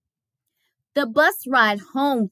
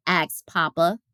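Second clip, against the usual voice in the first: plain reading voice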